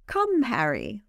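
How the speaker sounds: lightly purred summons; smoky purr. A narrator's reading. Leah calling Harry to come, lightly purred. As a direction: coax with a hint of a purr. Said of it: "Leah purred, her voice gone ... smoky again".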